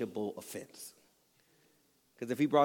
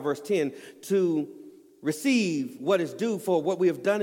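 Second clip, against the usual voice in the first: higher, louder full-force sermon; preaching; 6 semitones higher, 6 dB louder than usual. Acted. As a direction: drive the scripture home from the pulpit.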